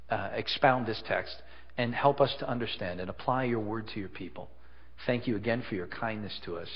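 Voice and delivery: soft, quiet pastoral prayer. Acted. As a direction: pray gently and sincerely. Pastor prays before the sermon.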